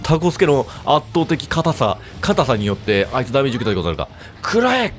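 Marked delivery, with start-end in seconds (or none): 4.4-4.9 screaming attack name. Real, not acted. Attack in the game.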